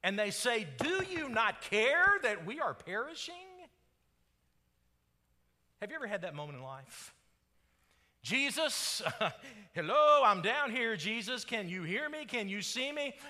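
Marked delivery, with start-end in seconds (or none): 0.8-3.4 high, loud, alarmed cry; desperate plea. Acted. Disciples waking Jesus in the storm, desperate plea. 8.2-13.1 raised, higher call for attention. Acted. Believer calling up to Jesus from down here, pleading call.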